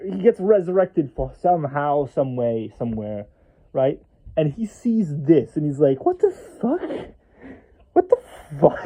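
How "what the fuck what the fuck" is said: high, squeaky outraged outburst; hysterical disbelief. Acted. Resurrected curse monger sees his curse backfire, hysterical disbelief. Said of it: "imagine his hysterical reaction".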